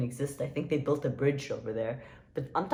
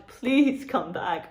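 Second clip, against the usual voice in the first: slow, loud, higher-pitched plea; hammed-up begging; 4 semitones higher, 7 dB louder, much slower than usual. Vlogger begging Taco Bell to return to Dubai, hammed-up begging. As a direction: drag out a playful desperate plea.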